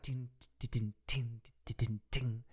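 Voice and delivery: soft tapped "tin, tin" cymbal sounds; imitating drum hits. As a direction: mimic the drummer under your breath. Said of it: "[name] goes on the right".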